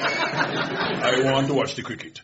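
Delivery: slow, drawn-out mock West Indian dad; West Indian accent. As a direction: put on a dad's West Indian patois. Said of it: "In that accent".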